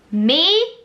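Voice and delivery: high, loud disbelieving "Me?"; replaying the moment she heard her name; stunned squeal. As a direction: gasp it out, unable to believe it. Reacting to winning a ticket.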